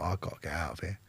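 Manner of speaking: low, quiet desperate mutter; despairing mutter. Retold or imitated. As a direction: say it under your breath in despair. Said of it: "I hated it".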